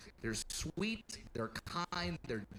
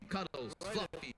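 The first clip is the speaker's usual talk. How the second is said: ordinary delivery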